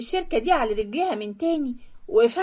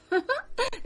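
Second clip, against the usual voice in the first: mocking laugh; 12 semitones higher, 6 dB quieter than usual.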